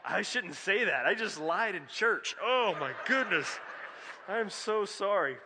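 embarrassed laughter. Real, not acted. Joking confession of speeding in church.

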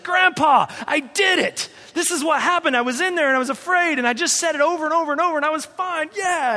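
put-on high, loud, rushing child's voice; excited kid. Boy tells his grandfather he did it, excited high rush. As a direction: burst out with triumphant news.

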